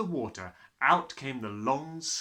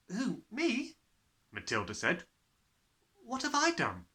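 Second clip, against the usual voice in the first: put-on timid child's voice; 9 semitones higher than usual. A narrator's reading. Matilda answers, frightened.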